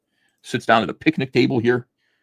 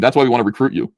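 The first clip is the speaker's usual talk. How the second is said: usual speaking voice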